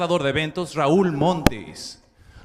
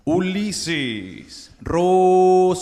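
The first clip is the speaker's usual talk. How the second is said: drawn-out ring announcer call; 4 dB louder, much slower than usual. Acted. Announcer calling fighter's name.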